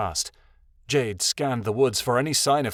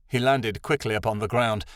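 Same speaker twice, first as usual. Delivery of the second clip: plain reading voice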